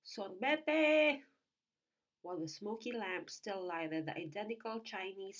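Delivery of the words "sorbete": high, loud street hawker call; sharp, ringing street call; shrill vendor's cry. A narrator's reading. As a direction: cry it out across the plaza to sell ice cream. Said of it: "repeating the same shrill cry".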